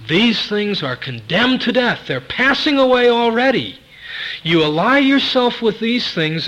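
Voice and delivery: high, loud pulpit warning; urgent, ringing exhortation; forceful preaching. Real, not acted. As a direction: preach at full force, pitch lifted high.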